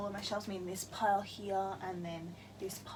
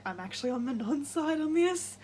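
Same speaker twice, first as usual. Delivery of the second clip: higher and louder, a mock defiant aside; siding with the villain; 6 semitones higher, 5 dB louder than usual. Reader reacting to the heroines' fight, mock defiant. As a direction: blurt it like a guilty confession. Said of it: "and I was just like".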